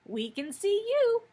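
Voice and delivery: very high, loud little piglet. A narrator's reading. Piglet answering the puzzled wizard, high piglet voice. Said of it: "said another of the piglets".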